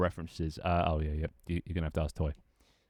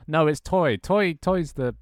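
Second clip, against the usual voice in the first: higher, louder, insisting it's Toy; playful indignant blame; excited insistence; 7 semitones higher, 5 dB louder, much slower than usual. Real, not acted. Streamer insisting to chat that Toy did the botting, higher and louder. Accusing Toy in mock outrage.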